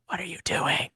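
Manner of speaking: soft, flat question; mild, soft ask; flat quiet version. Shown as a demonstration. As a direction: say it softly and flatly, no drama. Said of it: "instead of it being like a".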